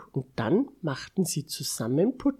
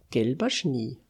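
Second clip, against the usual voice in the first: ordinary delivery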